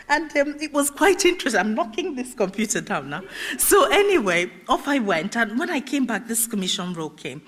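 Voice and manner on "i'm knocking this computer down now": ordinary talk, nothing marked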